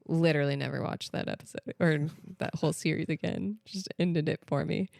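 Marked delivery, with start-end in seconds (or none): none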